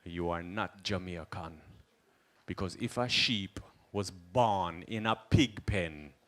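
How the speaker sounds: slow, soft Jamaican wisdom; a hint of a calm Rasta elder teaching; slow Rasta musing; lightly voiced Rasta sage. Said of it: "he's like".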